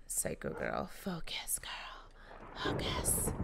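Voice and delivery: whispered self pep talk, no voice. Real, not acted. Player whispering to herself to focus, whispered. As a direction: hush it to yourself to focus. Coaching herself during play.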